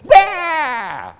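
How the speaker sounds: loud, high mock wail; imitated newborn cry. Preacher imitating baby after a slap, loud wail. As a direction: burst out bawling like a slapped baby. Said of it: "The next moment they go".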